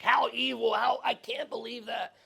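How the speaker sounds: high, slow, outraged voice; appalled king, drawn out; righteous indignation. Acted. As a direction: play David shocked at the injustice. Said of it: "David's righteous anger comes out now".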